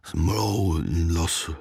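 low, garbled attempt at a word; groggy nonsense syllables; thick-tongued mumbling. A narrator's reading. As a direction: fumble the word with a heavy tongue. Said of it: "řekl něco jako mz".

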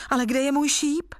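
high-pitched, mildly plaintive question; unhappy objection. A narrator's reading. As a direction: protest with a touch of dismay. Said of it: "namítl nejmladší bratr nešťastně".

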